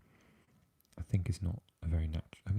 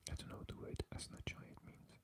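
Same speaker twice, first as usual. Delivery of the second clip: very soft whispered aside; 18 dB quieter than usual. Real, not acted. Puzzling over a Russian word for viewers, whispered. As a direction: whisper it, barely any voice.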